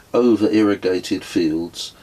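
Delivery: usual speaking voice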